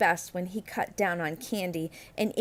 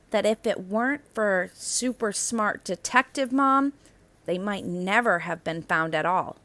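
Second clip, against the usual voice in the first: plain reading voice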